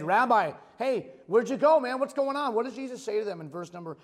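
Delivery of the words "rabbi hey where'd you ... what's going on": crowd calling out. Retold or imitated. Voicing the crowd seeking Jesus.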